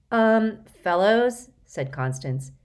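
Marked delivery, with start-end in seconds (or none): 0.1-1.5 higher, louder hesitant alert; nervous warning. A narrator's reading. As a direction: tentatively warn the boys. Constance warns others nervously.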